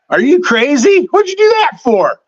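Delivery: imitating a stunned cop's outburst; incredulous cop. Retelling the cop who pulled him over, mock incredulous. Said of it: "He's like".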